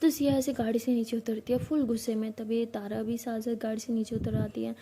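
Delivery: ordinary delivery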